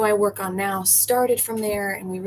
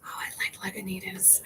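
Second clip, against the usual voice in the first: whispered aside; 11 dB quieter than usual. Real, not acted.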